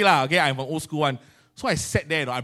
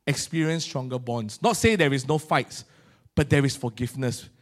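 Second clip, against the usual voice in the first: ordinary talk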